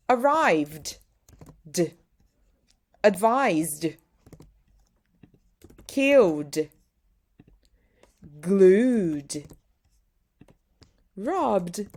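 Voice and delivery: "Arrived", "advised", "Killed", "Glued", "Robbed" end on a short "d"; voiced d endings. Shown as a demonstration. Teacher drilling "d" endings in the past tense. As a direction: clip each ending to a plain "d". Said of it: "ends with an R sound".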